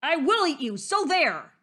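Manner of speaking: big exasperated wolf yell; roared. A narrator's reading. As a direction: roar a petulant threat. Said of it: "he roared".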